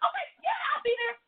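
high, tearful shout of joy; overjoyed shout. Retold or imitated. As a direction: burst out yelling with joy. Overjoyed reply, crying shout heard.

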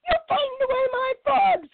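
very high, shrill screaming heron; screamed. Acted. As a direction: scream it like a cheated bird. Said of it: "screamed Longlegs".

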